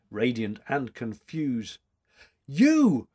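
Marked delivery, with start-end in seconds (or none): 2.5-3.1 high, loud surprised cry; cried out. A narrator's reading. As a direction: call out her name in joyful shock. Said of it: "she cried".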